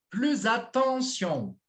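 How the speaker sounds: "Plus attention" lento e mais agudo, com nasal "an" alongada; modelo de pronúncia. Shown as a demonstration. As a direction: corrija devagar, esticando "attention" com som de "an". Professor corrige pronúncia do aluno. ("Plus attention" esticado, para repetir)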